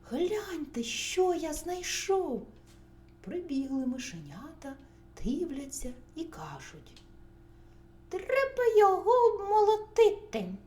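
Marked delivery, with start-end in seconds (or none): 0.1-2.5 higher, louder show-and-tell call; excited calling. A narrator's reading. Rooster shows the mice his find, excited calling. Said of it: "покликав півник". 8.1-10.5 high, loud little-mouse voice; squeaky mice. A narrator's reading. Mice tell rooster what to do, squeaky and loud.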